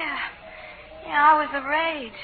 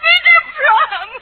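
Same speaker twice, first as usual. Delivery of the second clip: crying plea 1; 12 dB louder than usual. Acted.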